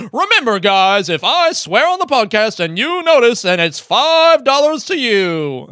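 put-on high, loud, stretched-out American accent. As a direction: ham up an American host's reminder. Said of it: "did that in an American accent".

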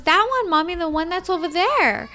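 put-on high little-girl voice; imitated insistent child. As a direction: insist like a small child pointing it out. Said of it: "And she goes".

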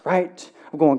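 normal talking voice

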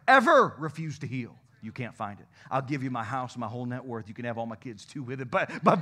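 normal talking voice

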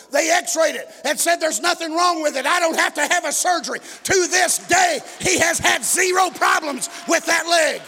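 loud preaching, stretched out at the end; rising testimony climax. Real, not acted.

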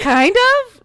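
high, loud hedge; sudden loud high hedge; kind of. Real, not acted. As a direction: squeak it out doubtfully. Hedging good and bad.

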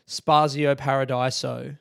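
Paradiso said slowly as para-DIE-so, R unrolled; slow, clueless English reading of Spazio Paradiso; mangled anglicised pronunciation. Shown as a demonstration. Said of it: "not roll the R at all".

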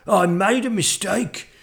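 high, loud dragon backing down; flustered dragon, stretching the end; spluttering dragon. A narrator's reading. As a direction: make hasty excuses as a scared dragon. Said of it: "he spluttered".

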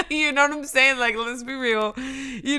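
amused and laughing out loud. Real, not acted. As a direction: talk through a real laugh. Breaks into laughter while listing.

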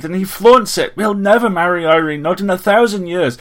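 high, very loud tirade; furious, shrill full-voiced rant; angry shouting. A narrator's reading. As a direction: shout it shrilly, losing control. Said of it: "He loathed his shouting voice".

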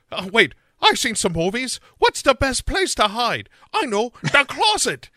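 high, loud, hammed-up brainwave; mock bright idea. Sarah picking a hiding place, hammed-up high excitement. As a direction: pitch it way up like a clueless horror heroine.

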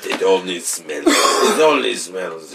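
put-on low, slow jokey Russian accent; mock Russian accent. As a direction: drop low in a bad Soviet Russian accent. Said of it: "I don't even know what I'm doing".

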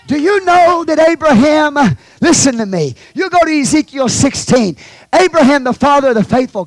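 high, loud preaching cry; preaching at full force. Real, not acted. Preacher pressing Abraham's story on the church, loud and high.

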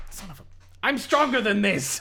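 high, hammed-up cry of effort; mock-heroic struggle to break free; straining defiance. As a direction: strain against the ropes, defiant. Player's hero struggles against ropes.

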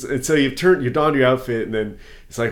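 normal talking voice

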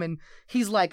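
ordinary talk, nothing marked

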